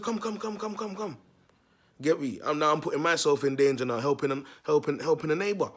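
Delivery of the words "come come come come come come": urgent beckoning. Retold or imitated. Urgently beckoning a fleeing neighbour.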